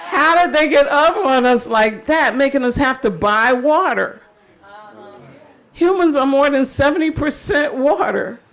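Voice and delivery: impassioned protest poetry, projected to the crowd; spoken-word poem. Acted. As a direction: hit the refrain with rising force. Returns to the poem's refrain.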